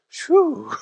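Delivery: high comic sigh of relief; relieved phew. Retold or imitated. Husband enjoying the silent treatment, relieved phew. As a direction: blow out a happy phew.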